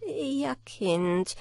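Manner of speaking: lightly voiced glum old woman; sorrowful grandmother. A narrator's reading. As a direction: answer with a sigh of regret. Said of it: "recht grämlich und sprach".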